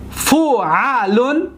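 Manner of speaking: فعلون very slow, each syllable drawn out. Shown as a demonstration. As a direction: sound out فعلون as fu, a, lun. Teacher models pattern by syllables.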